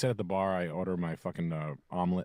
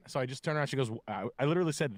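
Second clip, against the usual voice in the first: ordinary delivery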